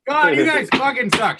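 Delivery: high, loud laughing groan at the bad accents; exasperated yell. Real, not acted. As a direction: let it burst out in fed-up shouting. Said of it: "now you're just making noises".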